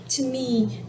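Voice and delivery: ordinary talk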